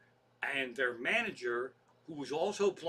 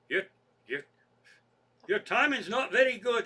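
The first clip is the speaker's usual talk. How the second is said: higher, louder attempt at British accent; botched British accent; 5 semitones higher, 6 dB louder than usual. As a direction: badly fake a British rock star's accent. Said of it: "now I can't do a British accent".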